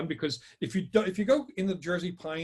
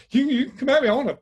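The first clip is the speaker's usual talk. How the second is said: higher, louder panicked threats at the dark; a frightened man daring the woods to come at him; terrified bravado; 7 semitones higher, 5 dB louder, faster than usual. Retold or imitated. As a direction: yell scared defiance at an unseen attacker. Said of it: "but I was so terrified".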